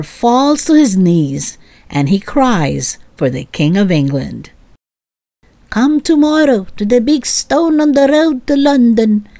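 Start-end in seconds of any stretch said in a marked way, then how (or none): 5.7-9.3 higher-voiced servant delivering orders; servant announcing. A narrator's reading. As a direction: announce it like a royal messenger. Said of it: "says the servant".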